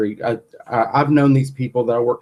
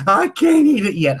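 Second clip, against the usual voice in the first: higher, whiny reluctant voice; mimicking her whiny protest; 5 semitones higher, 3 dB louder than usual. Husband mimicking her protest, whiny and pitched up. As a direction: whine like a scared patient. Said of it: "mimics her whining protest".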